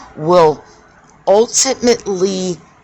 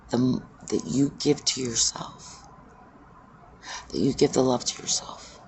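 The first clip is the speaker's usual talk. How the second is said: much softer, hushed meditative voice; soft guided meditation; 4 semitones lower, 9 dB quieter than usual. Acted. Speaker guiding listeners to see themselves in the circle, hushed meditative voice. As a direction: draw the listener inward, gently and quietly.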